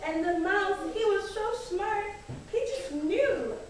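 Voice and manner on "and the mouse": ordinary delivery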